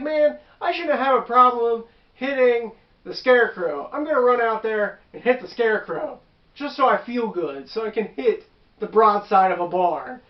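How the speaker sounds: mock mad player. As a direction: imitate a frustrated buddy venting. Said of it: "He gets so mad".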